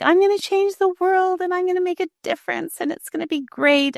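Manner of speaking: high, bright mock-idealist voice; lifted pitch, wide-eyed hopeful quote; naive optimist. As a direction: play your younger, starry-eyed self. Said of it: "I have rose-colored glasses on".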